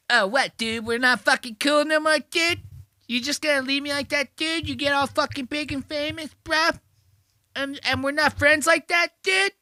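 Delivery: very high, whiny caricature; mock aggrieved texter. Random person texts a famous comedian, mock-aggrieved whine. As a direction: push it high and strained, mocking a wounded bro. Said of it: "what to you is a random fucking person going".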